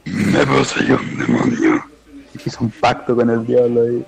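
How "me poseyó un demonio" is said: slow, lower possessed demon voice; demonic voice. Retold or imitated. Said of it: "hace tu voz demoníaca".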